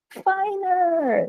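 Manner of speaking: high excited call of the acronym; high bright exclamation; cheering the answer. Acted. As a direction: belt it out like a cheer for the class. Teacher shouts acronym answer to class.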